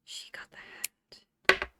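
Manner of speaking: slow astonished whisper; shocked whisper. Real, not acted. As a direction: gasp and breathe it out slowly. Gasp then whisper.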